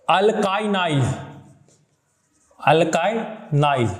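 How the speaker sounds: slow, spaced-out "अल्काइनाइल"; syllable by syllable. Shown as a demonstration. Teacher introducing a new chemistry term, syllable by syllable. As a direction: draw "अल्काइनाइल" out in separate syllables.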